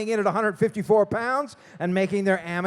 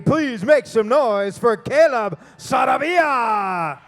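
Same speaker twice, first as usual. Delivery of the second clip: shouting hype; 2 semitones higher, 10 dB louder, slower than usual. Real, not acted. Announcer hyping crowd.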